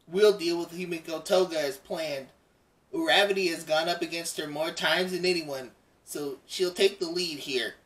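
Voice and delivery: lightly voiced frog girl, matter-of-fact; a hint of a cool, level hero girl; calm Froppy. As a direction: reader voicing Froppy explaining the plan, mildly in character. Froppy's lines read in voice.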